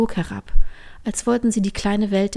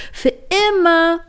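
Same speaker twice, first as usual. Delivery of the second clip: joyful call; 9 semitones higher, 5 dB louder than usual. A narrator's reading. Line calls while jumping on the ice, high shout. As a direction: call it out mid-jump. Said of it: "rief Line".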